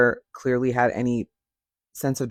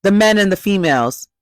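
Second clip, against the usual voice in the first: higher, louder dudebro caricature; mocking men; 5 semitones higher, 5 dB louder than usual. Mimicking men who say the females, mocking caricature. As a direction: sneer at how guys talk. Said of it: "when y'all be doing".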